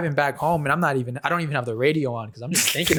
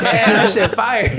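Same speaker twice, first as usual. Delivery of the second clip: a little higher and louder, laughing through it; laughing boast; 7 semitones higher, 3 dB louder, slower than usual. Retold or imitated. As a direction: chuckle out a cocky self-quote. Boasting about himself, laughing.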